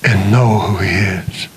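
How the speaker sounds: slow, intense sermon building to a hushed close; earnest preacher lowering to a hush; preaching. Acted. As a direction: preach it, then drop to a whisper. Preaching to congregation.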